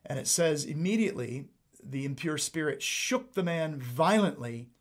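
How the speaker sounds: ordinary talk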